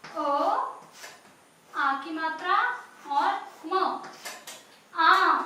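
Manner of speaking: अ, matra, म named apart, then आम called out loud; sounding out letters. Shown as a demonstration. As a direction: piece आम together, end loud. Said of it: "तो आम कैसे लिखेंगे हम?". (आम को अक्षर जोड़कर बोलना)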